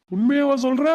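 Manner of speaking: stunned disbelieving question; surprised. Acted. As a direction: ask it in disbelief. Stunned by the news.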